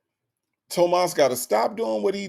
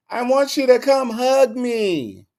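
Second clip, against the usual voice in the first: needy mother; 6 semitones higher than usual. Acted. Mother voiced asking for hug.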